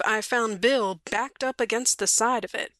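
usual speaking voice

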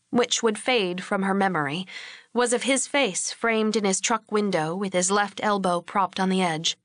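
ordinary talk

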